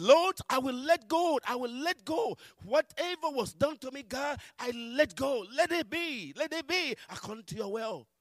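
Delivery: high, loud cry of release; impassioned surrender. Retold or imitated. Believer surrendering the hurt to God, impassioned. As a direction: cry out, letting it all go.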